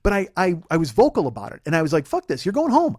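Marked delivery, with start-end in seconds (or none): 2.1-3.0 high, loud furious retort; angry outburst. Retold or imitated. To girlfriend in the car, a high angry outburst. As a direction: blow up at her and send her home.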